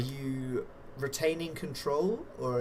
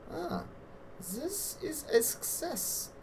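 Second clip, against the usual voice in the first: high-pitched reaction; 5 dB quieter, slower than usual. Real, not acted. Game master reads the dice result.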